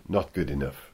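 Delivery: low, quiet, deadpan verdict; glum team boss. Retold or imitated. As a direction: drop it low and dour. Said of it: "Bouillabaisse Soup said".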